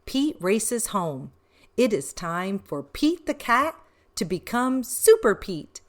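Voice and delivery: plain reading voice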